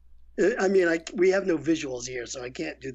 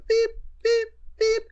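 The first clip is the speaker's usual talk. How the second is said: high, loud beep beep beep imitation; imitated beeps; 4 dB louder than usual. Director imitating the three ADR cue beeps, high and loud. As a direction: mimic the three cue beeps of a dubbing booth. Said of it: "So you go".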